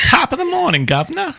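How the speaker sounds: very high cartoon British-Irish greeting accent; mock British accent. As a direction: squeak out a hammed-up "governor" British accent. Joke accent after accent game.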